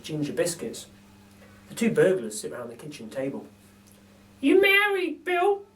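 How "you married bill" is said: very high, slow, chirpy lady voice; a cheeky cockney burglar lady; Burglar Betty. A narrator's reading. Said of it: "says Burglar Betty".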